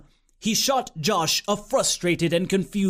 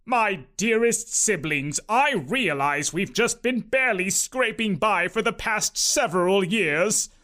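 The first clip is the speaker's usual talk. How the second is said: proud uncle. A narrator's reading. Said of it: "proud to make his big announcement".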